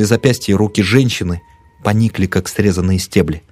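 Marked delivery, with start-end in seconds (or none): none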